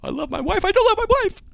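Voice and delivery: flip-flop in a mock high shout; mocking parody. Host parodies a self-contradicting statement, high mocking voice.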